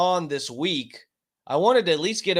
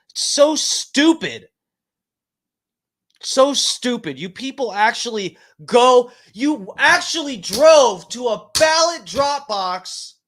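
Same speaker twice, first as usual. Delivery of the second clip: shouted, higher and louder, dragging out the words; screaming rant; 6 semitones higher, 5 dB louder than usual. Real, not acted. Streamer ranting at voters about burned ballots, screaming. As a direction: yell it in real frustration at the voters. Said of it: "I'm sorry I'm screaming".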